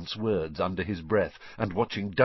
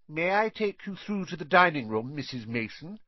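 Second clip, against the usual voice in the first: put-on a higher, polite voice for a spoiled boy; Dudley voice; 8 semitones higher than usual. A narrator's reading. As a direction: put on company manners. Dudley acting the host.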